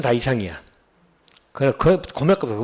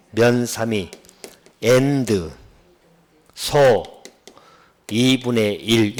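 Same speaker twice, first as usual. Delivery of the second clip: "면 삼이", "and", "소", "이분의 일" as separate beats; chunked reading demo; much slower than usual. Shown as a demonstration. Teacher showing students how to read the shorthand, in separated chunks. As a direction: pause firmly after 면 삼이, after and, after 소. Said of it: "제가 이렇게 읽을 거예요".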